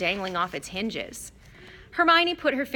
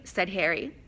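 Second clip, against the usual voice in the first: ordinary delivery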